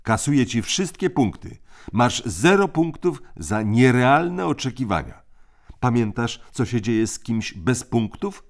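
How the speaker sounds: crisp orders from the eldest; bossy older brother. A narrator's reading. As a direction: lay down the rules like a little tyrant. Tim punishing Jane.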